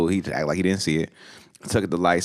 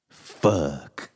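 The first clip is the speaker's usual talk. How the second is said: low-key, drawn-out curse; deflated curse; 3 semitones lower than usual. Retold or imitated. Brian in the silence after being yelled at, drawn-out deflated curse. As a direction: let it sink out slowly after a silence.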